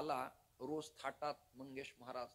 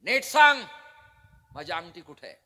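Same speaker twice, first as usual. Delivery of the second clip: loud, high angry demand; challenging shout; 4 semitones higher, 21 dB louder than usual. Acted. Arjuna angrily demanding his ring. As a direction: bark the demand like a war cry. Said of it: "हा ललकारीचा प्रतिध्वनी आवाज".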